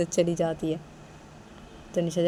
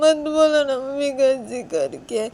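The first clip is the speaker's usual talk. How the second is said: high, loud drawn-out wail; Manisha crying; 9 semitones higher, 8 dB louder, slower than usual. A narrator's reading. Girl begging friend not to scold mummy, tearful wail. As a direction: plead in an acted sobby whine, slow and pitched up. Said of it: "अब तुम रोना बंद करो".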